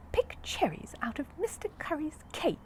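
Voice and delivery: high, breathy indignant cry; offended exclamation. A narrator's reading. Paddington, offended by the suggestion, exclaims high. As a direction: gasp it out, scandalised. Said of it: "he exclaimed".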